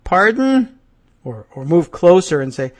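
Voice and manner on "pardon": high, loud questioning call; hard-of-hearing query. Acted. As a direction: ask as if you didn't catch it. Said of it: "put your hand to your ear and say".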